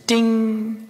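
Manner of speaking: ordinary talk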